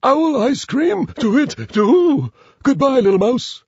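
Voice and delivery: high, loud hooting owl; alarmed owl. Acted. Owl fears becoming ice cream, high alarmed hoot. Owl takes fright and leaves.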